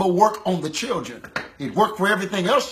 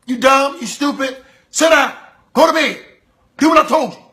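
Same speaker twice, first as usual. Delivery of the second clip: put-on loud, high yelling at a child; harsh parent shouting at the children; scolding parent; 5 semitones higher, 4 dB louder than usual. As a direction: bark insults at your kid. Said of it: "See, you can tell them".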